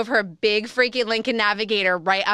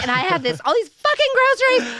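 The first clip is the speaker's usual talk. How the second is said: frantic; 12 semitones higher, 6 dB louder than usual. Retold or imitated. Frantic, flipping out.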